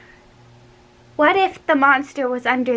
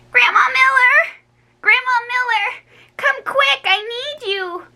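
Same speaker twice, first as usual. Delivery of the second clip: shouted for help; 13 semitones higher than usual. A narrator's reading. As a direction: scream for Grandma to come. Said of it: "I shouted".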